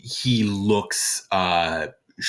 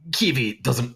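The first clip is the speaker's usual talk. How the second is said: usual speaking voice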